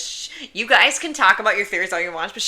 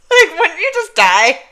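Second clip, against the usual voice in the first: laughing, louder and high; 9 semitones higher, 10 dB louder than usual. Real, not acted. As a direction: squeal it through laughter. Giggling over fan theory.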